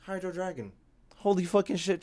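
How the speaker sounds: usual speaking voice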